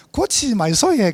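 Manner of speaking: put-on light Busan accent, pitch up; 경상도 사투리로 가볍게; Gyeongsang dialect. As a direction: teasingly put on a Gyeongsang twang. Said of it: "사투리 구수하네".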